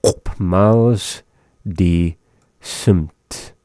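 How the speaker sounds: "Op miles, die, simt" in slow separate pieces; word by word. Shown as a demonstration. Teacher breaking down snow blowing phrase for learners, word by word. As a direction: pause after "miles" and after "die".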